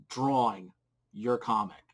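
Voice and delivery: ordinary delivery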